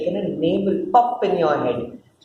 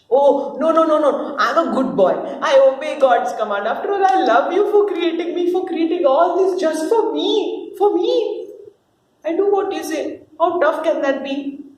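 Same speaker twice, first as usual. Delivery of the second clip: put-on eager good boy; 6 semitones higher than usual. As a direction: protest too much like a child. Adam protests his innocence.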